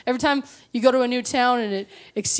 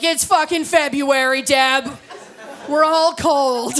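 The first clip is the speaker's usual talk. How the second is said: mock exasperated retort; 3 semitones higher, 3 dB louder, slower than usual.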